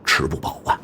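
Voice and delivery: hushed voiceless aside; whispered aside. Acted. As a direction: whisper it like a secret.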